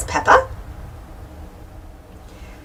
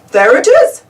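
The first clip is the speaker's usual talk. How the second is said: raised, higher excited shout; 6 semitones higher, 7 dB louder than usual. A narrator's reading. Daddy Pig spots the lizard on a rock, shouting. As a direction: call it out loud and high. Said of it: "Daddy Pig shouts".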